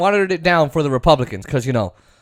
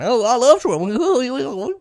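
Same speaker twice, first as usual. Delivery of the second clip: high, slow, gushing impression; Lindsey Graham; 7 semitones higher, much slower than usual. Trump's best friend professes love, gushing impression. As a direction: swoon it out in a high put-on voice. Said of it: "Lindsey Graham is, like, Trump's best friend".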